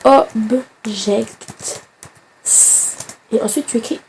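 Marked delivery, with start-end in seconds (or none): 0.0-1.8 English word object in a French accent, slow and rising. Shown as a demonstration. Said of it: "je parle avec l'accent français pour bien que tout le monde comprenne". (object dit avec l'accent français, lent et montant)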